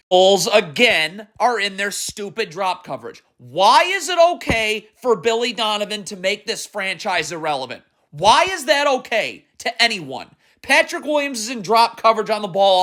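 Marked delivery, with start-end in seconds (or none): none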